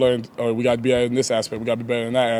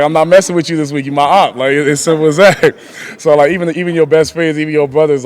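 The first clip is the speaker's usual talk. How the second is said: loud, higher-pitched mock warning; playful trash talk; 4 semitones higher, 10 dB louder than usual. Player playfully warns his friends turned rivals, loud trash talk. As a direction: tell off a friend with amused, raised voice.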